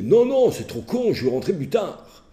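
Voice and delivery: put-on high, slow teenage whine. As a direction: drag it out like a sulky teen. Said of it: "il me disait".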